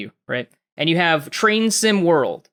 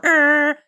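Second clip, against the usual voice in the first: steady held honk, the lower note; low train horn; 10 semitones higher, 3 dB louder than usual. Retold or imitated. Showing the train horn's low setting, lower held honk. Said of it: "you can go high or low".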